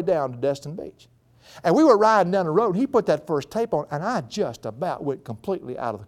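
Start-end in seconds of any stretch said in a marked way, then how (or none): none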